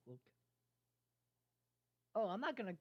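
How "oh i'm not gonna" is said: higher and louder, brushing it off; quick, raised protest; amused denial. Acted. Pegasus laughs off the idea.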